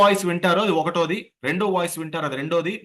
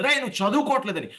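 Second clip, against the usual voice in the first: higher-pitched mock telling-off; mock scolding yell; 7 semitones higher than usual. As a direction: act out a parent wanting to yell. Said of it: "చాలా గట్టిగా అరవాలనిపిస్తుంది". (పిల్లలను తిట్టినట్టు నటన)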